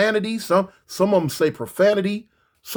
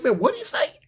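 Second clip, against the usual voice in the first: rising higher in disbelief; baffled; 3 dB quieter than usual. Retold or imitated. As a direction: squeak it out, baffled. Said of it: "in my mind, I'm like".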